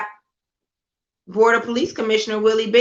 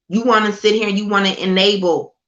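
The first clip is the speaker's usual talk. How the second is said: ordinary talk, nothing marked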